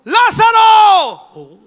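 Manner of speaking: high, long-drawn shout; booming, stretched-out summons; great loud call. Acted. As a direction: call the dead out with full voice. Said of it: "llamó a gran voz".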